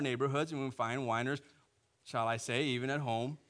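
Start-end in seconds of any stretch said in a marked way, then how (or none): none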